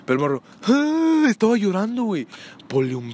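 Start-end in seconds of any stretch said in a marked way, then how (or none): 0.6-1.3 very high mock toddler wail; loud shrieking kid imitation; crying wail. Imitating the boy crying over the toys.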